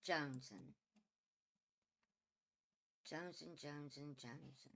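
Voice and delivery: low, hushed name calling; soft calling. Real, not acted. Quietly calling the pet by name, hushed.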